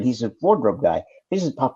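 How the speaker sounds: ordinary delivery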